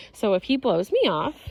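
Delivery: ordinary talk, nothing marked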